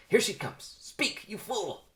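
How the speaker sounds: high, urgent self-scolding, slowing at the end; debating with himself. Acted. Barry as Vanessa approaches, panicky self-urging. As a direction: order yourself to speak up. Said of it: "Begins debating with himself".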